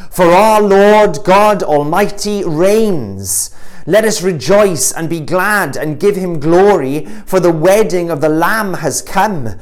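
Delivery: loud, high, slow exultant proclamation; proclaiming shout. A narrator's reading. Preacher voicing the great multitude in heaven, loud proclamation.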